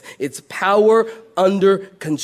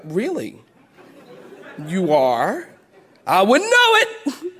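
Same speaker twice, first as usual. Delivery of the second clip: sarcastic agent; 3 semitones higher, slower than usual. Retold or imitated. Sarcastic agent retold by pastor.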